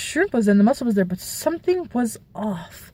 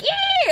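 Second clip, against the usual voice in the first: very high, short disgusted squeal; a sharp, very high squeal of revulsion. Retold or imitated. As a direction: shriek as something gross touches you. Antenna touches, throws shrimp.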